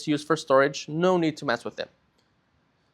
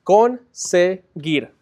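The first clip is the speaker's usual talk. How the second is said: CONSEGUIR slowly split as con, se, guir; three separate syllables; 2 semitones higher, 4 dB louder, much slower than usual. Shown as a demonstration. Teacher counting the syllables of a word, syllable by syllable. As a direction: sound out "Conseguir" in three slow, spaced beats. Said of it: "which is a three-syllable word".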